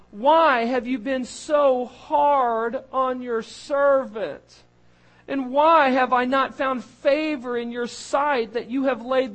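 high, loud, slow anguished plea; dramatic Moses lament. Acted. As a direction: cry out to the Lord as a burdened servant. Reading Moses's complaint dramatically.

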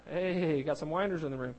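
ordinary delivery

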